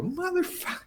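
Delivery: very high, strained mock curse; mock outburst. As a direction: squeak the curse out in disbelief. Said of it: "I go".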